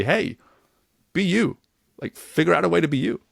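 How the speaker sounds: encouraging quote. Retold or imitated. Coach urging a client to be authentic, lifted and encouraging. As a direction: cheer someone on to be themselves. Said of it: "empowering someone to say".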